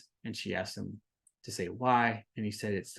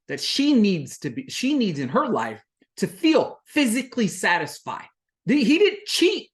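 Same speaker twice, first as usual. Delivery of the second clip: heated, much higher and louder rant; fired up; 8 semitones higher, 4 dB louder than usual. Real, not acted. Recapper scolding the man on the show, fired up. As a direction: lecture him hard, voice climbing. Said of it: "I'm a little fired up here".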